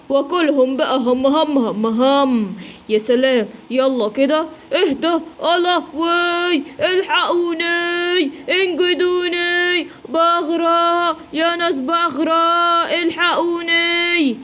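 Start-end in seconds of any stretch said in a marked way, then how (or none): 5.4-14.4 slow, stretched panicked wails; drowning cry for help. Acted. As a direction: call out for help as if sinking. فلافيلو يغرق ويستغيث. (صراخ استغاثة ممطوط)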